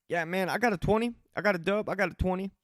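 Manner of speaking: lightly voiced hustler pushing his bag; mildly hammed-up dealer sales pitch; street dealer pitch.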